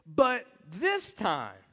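drawn out, heavy stress on this; slow emphatic. Shown as a demonstration. Preacher slowly teasing the congregation's excuse. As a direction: stretch out but this time. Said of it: "and then we say this".

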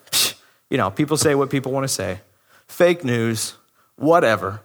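low, soft, offhand brush-off; mock a casual "whatever", voice dropped low; dismissive shrug-off. Preacher imagines Jesus shrugging off the Pharisees, dismissive.

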